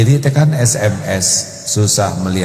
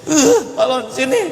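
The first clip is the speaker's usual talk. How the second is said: put-on demanding child.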